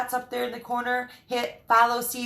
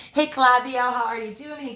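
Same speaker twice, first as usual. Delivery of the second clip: ordinary delivery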